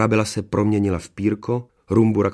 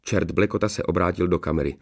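plain reading voice

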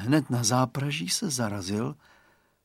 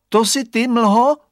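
high, loud call into the fog; a child calling to something unseen; 10 semitones higher, 10 dB louder than usual. A narrator's reading. Radovan calling to the fog, loud questioning call.

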